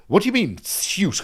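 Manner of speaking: furious disbelief. Retold or imitated. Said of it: "Fucking absolutely furious".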